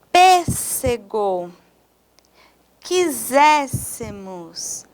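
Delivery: each word read slowly, stressed syllable leaned on. Shown as a demonstration. Teacher reading a word list to students, slow and deliberate. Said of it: "precisamos de acentuar todas as palavras proparoxítonas".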